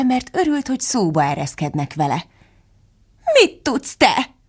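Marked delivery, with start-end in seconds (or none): 3.4-4.4 high-pitched, belittling question; sniffy, dismissive Duchess; scornful. A narrator's reading. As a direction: put the child down with disdain. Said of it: "szólt fitymálva a hercegnő".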